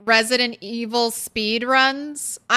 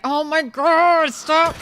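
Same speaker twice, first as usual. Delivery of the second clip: exasperated; 5 semitones higher, 4 dB louder than usual. Real, not acted. Cat chewing the headphones.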